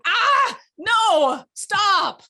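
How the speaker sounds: high, loud shout of alarm; sudden loud "Ah, no, stop". Shown as a demonstration. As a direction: yell it to stop them cold. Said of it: "hear my voice in your head saying".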